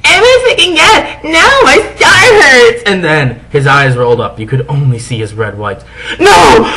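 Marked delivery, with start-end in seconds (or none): 0.0-2.8 high, loud anguished scream; screaming in pain. Acted. Vampire screaming that his star hurts. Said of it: "he started to scream". 6.2-6.6 high, loud shocked no; alarmed cry. Acted. Girl crying out at his collapse, alarmed.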